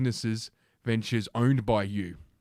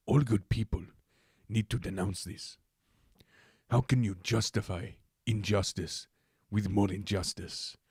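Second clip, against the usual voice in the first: lightly put-on Cuban accent, softer; mock Cuban accent; 3 dB quieter than usual. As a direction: read the quote in a hammed-up Cuban accent. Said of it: "That wasn't even close to a Cuban accent".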